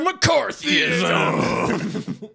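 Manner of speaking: very slow, high, shouted announcement; mock announcer shout. Announcing the villain's arrival, slow mock shout. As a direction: drag it out like a ring announcer.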